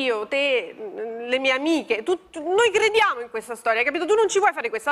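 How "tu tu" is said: ordinary talk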